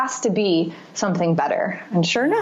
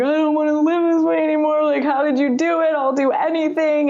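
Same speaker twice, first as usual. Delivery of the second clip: retold crying plea; 8 semitones higher than usual. On the phone to her sober friend, tearful pleading. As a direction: plead through tears for help. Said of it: "And I'm crying".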